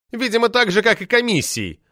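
high mocking jab at the boss; scornful poetess smirking; sneering quip. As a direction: snort out the jab. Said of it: "хмыкнула Метелина".

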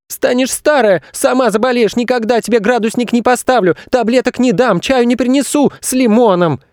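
put-on high-pitched child's tantrum; boy shouting. A narrator's reading. Boy threatening his mother in a tantrum, shouting. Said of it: "кричал Федя".

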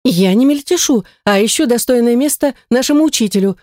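offended defense of her fussing; indignant. A narrator's reading. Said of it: "возмутилась она".

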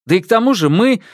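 stubborn insisting. Acted. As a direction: keep pushing your point. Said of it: "продолжил гнуть своё".

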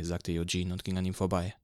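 usual speaking voice

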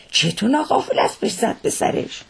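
high-pitched incredulous question; baffled disbelief. Acted. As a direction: ask it high, in sheer bewilderment. Coachman baffled by the accident.

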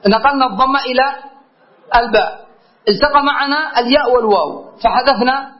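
ordinary delivery

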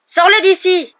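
high, loud panicked yell; hurlement. Acted. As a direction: scream it as the cat attacks. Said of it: "hurla celui-ci". (Ron hurle, très aigu et fort)

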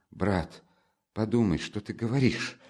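usual speaking voice

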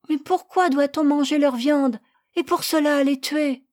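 put-on high, loud pleading little boy; child's voice. Boy begging his parents to spare the lamb, high and loud. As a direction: plead like a small boy defending his pet.

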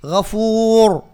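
غفور drawn out to a thick final r; slow, recited غفور with full-mouthed ending; heavy ra. Shown as a demonstration. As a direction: stretch the "uu" and darken the r of غفور. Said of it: "الراء مفخمة".

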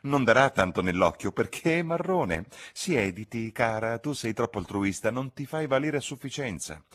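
high, theatrical spinster, teasing; Miss Lavish shooing Lucy. Acted. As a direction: shoo the girl away, archly. Lavish's character voice. (zitella teatrale che scaccia la ragazza)

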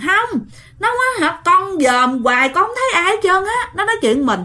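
high, urgent insisting; insistent child. Retold or imitated. Child insisting to mom, high and urgent. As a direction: press the point up high.

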